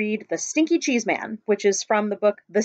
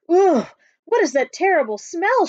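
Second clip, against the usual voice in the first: disgusted cry; 6 semitones higher, 5 dB louder than usual. Acted. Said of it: "she cried".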